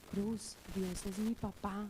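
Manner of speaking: hushed, slow secret, low-pitched; re-enacted murmur into a friend's ear; confiding in ear. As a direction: lean in and share it like a secret. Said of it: "yo le dije al oído".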